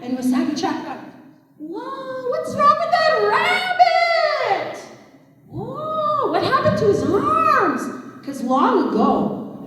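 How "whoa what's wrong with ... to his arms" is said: very high, drawn-out storybook amazement; astonished exclamation. Acted. Trickster spots a strange rabbit in the field, astonished. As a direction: gawp aloud in a high stretched voice.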